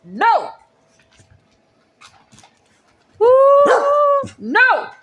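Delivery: loud high protest; shouting no. Real, not acted. Shouted protests during voice game.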